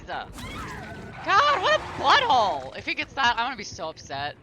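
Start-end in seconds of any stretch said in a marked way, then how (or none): 0.0-2.8 higher, louder, fed-up jab at the player; real annoyance, pitched up and louder; annoyed outburst. Real, not acted. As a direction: let the irritation burst out loud. Annoyed at opponent in game.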